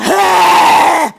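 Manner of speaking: loud, sustained mock snarl; imitated angry snarl. Showing what the man did to Marcel, imitated snarl. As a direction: let out a long harsh growl. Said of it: "he did this to Marcel".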